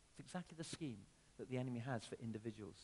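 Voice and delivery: ordinary talk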